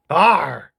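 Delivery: loud creature grunt of Arr. Acted. Agnew the creature replies to host with loud Arr. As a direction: Agnew grunts his point. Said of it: "Yes, that could also".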